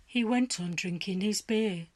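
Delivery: plain reading voice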